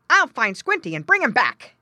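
loud, high-pitched talking dog. A narrator's reading. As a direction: call it out as an eager farm dog. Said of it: "That meant, in dog language".

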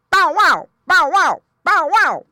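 loud high dog bark. A narrator's reading. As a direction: bark it out forcefully, three times. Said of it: "barked Don".

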